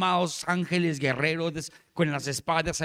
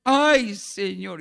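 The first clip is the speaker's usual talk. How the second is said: imitated anguished cry; 2 semitones higher, 6 dB louder, much slower than usual. Pastor imitating the pastora's outcry, mock anguish. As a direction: imitate a startled "ay", bursting out then dropping. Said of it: "la pastora hizo así".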